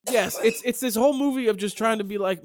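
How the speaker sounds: ordinary delivery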